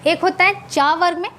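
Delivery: ordinary talk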